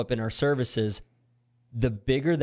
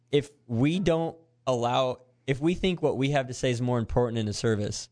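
ordinary talk, nothing marked

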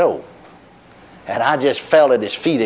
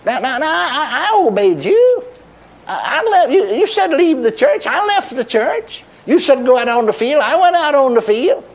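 high, insistent self-defense, slowing at first; arguing with the Lord; 11 semitones higher than usual. Retold or imitated. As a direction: plead your case to God, pitch raised high. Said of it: "here I go to arguing with him again".

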